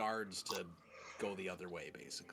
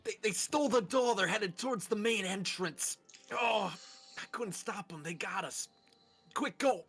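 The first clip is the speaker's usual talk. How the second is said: frantic, higher wounded guard voice; panicked injured guard; 6 semitones higher than usual. Acted. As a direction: sell the act of a hurt guard. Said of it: "I just go".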